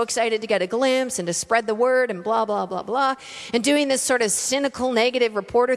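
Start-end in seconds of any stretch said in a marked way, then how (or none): none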